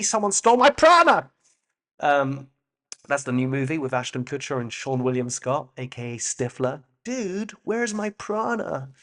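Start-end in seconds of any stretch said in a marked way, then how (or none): 0.0-1.3 high, loud comic wail of outrage; exaggerated, higher and louder indignant cry; mock outcry. Mock outcry over stolen prana. 7.0-8.9 high, drawn-out dude voice; spoofed film-title delivery, pitched up; mock movie slacker. Spoofs a stoner comedy film title.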